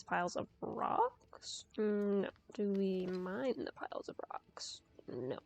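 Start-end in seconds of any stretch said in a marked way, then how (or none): 2.5-5.4 whispering. Real, not acted. Muttering to herself while puzzling.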